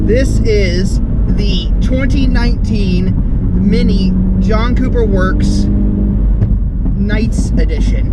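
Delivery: "this is the twenty nineteen" said loud and slow, each word drawn out; loud slow presenter take. Shown as a demonstration. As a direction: announce the model name loud and slow like a host. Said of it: "There, that's much better".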